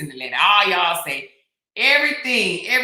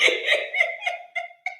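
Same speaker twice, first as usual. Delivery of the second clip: high laughing squeal. Real, not acted.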